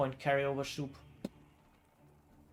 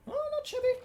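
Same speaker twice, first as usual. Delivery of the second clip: excited; 5 dB louder, faster than usual. Real, not acted. Impressed caster reacting to play.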